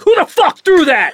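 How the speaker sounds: loud outburst, caught off guard; mock outraged yell as the act drops; startled real voice. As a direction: blurt it out loudly. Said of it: "that's your real voice".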